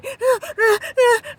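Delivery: sharp high gasp of shock. Retold or imitated. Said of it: "'cause I'm still in shock".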